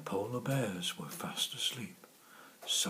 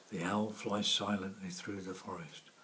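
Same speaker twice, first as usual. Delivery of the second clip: usual speaking voice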